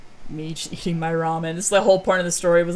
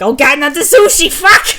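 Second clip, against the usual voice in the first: high, loud anguished yell; mock horror; 8 semitones higher, 12 dB louder than usual. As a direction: recoil from the sushi in hammed-up dread. Character horrified by sushi.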